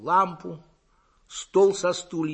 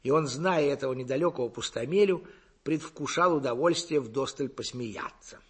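plain reading voice

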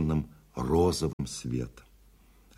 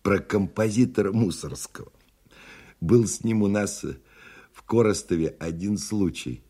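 lightly voiced sly old man, raised pitch; 5 semitones higher than usual. A narrator's reading. Old man starts a tale for fellow passengers, mildly sly. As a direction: open a tale with mild slyness. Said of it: "лукаво сказал старичок".

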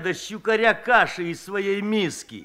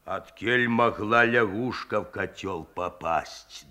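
interrogating; 9 semitones lower, 3 dB quieter, slower than usual. A narrator's reading. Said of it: "допытывался Любишкин".

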